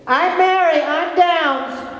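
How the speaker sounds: high, bright imitation of a woman introducing herself; imitating Mary. Imitating a woman with Down syndrome greeting the speaker, high and eager. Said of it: "this is the way she".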